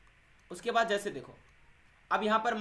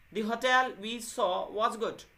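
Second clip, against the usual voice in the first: "the hotel we saw was good" said very slowly and a bit louder; example sentence; 4 semitones higher, 5 dB louder, much slower than usual. Shown as a demonstration. Teacher slowly dictates the second example to students. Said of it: "किसी दूसरे को explain करना है".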